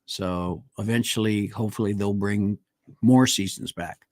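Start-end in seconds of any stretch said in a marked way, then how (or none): none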